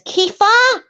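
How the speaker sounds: high, loud squawked name; Patty. Retold or imitated. Host mimics Patty for laughs, high squawked impression. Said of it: "Patty says".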